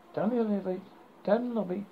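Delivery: usual speaking voice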